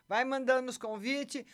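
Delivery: normal talking voice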